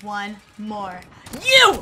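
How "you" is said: very high, loud single-word outburst; frustrated yell. Real, not acted. Player to the enemy one kill short, frustrated yell. Yelling at an opponent.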